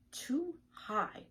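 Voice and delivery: lightly voiced nervous egg; fearful egg. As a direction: shrink back from the height.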